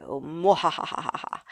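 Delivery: low, hammy villain laugh; evil cackle. Pretend.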